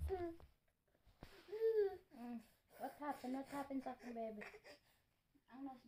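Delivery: hushed, tender what happened; soft comforting. Real, not acted. Soft comforting after scolding. (hinay ug malumo nga pagpakalma)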